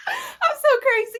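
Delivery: high squealing laugh through words; cracking up at herself. Real, not acted. Laughing at her own story.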